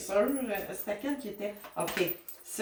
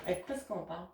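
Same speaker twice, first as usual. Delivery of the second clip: softer, muttered repeat of the question; quiet repeat; 9 dB quieter, faster than usual. Real, not acted. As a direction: ask it again, half to yourself.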